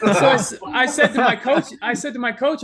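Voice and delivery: laughing through the words. Real, not acted. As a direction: chuckle while telling it. Laughing while retelling.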